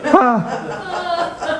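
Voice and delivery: high shouting pep talk to patients; rousing shouts. Retold or imitated. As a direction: urge everyone to scream it out. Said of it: "encouraging ... to scream out their pain".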